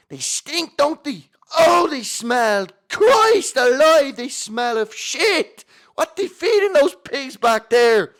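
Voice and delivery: very high, loud, drawn-out wail; hysterical disgusted passenger. Acted. Seated passenger revolted by the standing section, shrieking outburst. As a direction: screech it out in outraged disgust.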